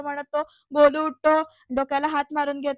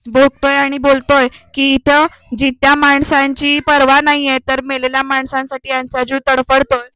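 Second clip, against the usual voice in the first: loud, forceful imitated grumbling; retold anger; 14 dB louder than usual. Nageshwar raging that the living are neglected, retold angrily. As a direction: act out his angry complaint, firmly projected. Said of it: "कसा असा रागाने".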